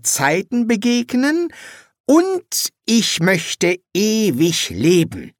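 high, slow and commanding; a small figure reading out its wishes; summoner voice. Acted. Summoner demands three wishes, high and slow.

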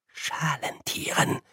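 leises, langsames Flüstern. Acted. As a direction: dem Rektor das Wort zuflüstern. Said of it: "flüsterte Ponda Stibbons". (Stibbons flüstert die Korrektur, langsam und leise)

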